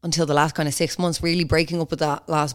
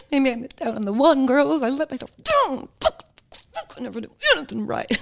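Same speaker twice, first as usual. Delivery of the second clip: high, hammed-up wailing; mock despair; 8 semitones higher than usual. Podcaster joking to girls about a failed date, mock despair. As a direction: fake a tearful meltdown, speeding up at the end.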